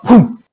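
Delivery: loud boom. Acted. Narrating the explosion.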